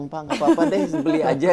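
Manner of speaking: a caller urging the buyer to go ahead; pleading seller. Retold or imitated. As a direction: coax the buyer into buying. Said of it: "ditelepon ada yang sampai datang".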